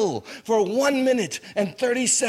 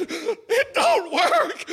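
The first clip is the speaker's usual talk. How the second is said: mock panic; 10 semitones higher, much slower than usual. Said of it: "and I said".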